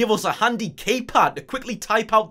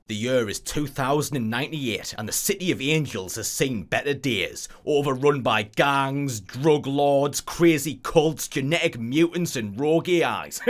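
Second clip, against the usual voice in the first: movie trailer narration; 4 semitones lower than usual. Acted. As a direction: read it like a dystopian trailer. Narrating intro lore.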